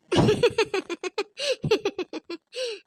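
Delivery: rising wicked cackle; gloating villain laugh; evil cackle. Acted. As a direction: cackle at the fool she's trapped. Schemer gloats again.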